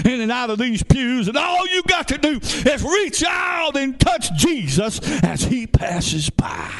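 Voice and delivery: high-pitched preaching building to a shout; fervent preaching. Real, not acted. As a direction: climb in pitch to a shouted climax. Preaching at full force.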